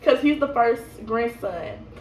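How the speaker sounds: ordinary delivery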